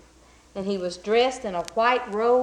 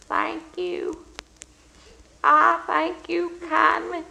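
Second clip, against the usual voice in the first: put-on high, thin, slow old man's voice; old beggar thanking the blacksmith; feeble old beggar; 7 semitones higher, slower than usual. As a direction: quaver it like a frail old beggar. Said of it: "said that old beggar man".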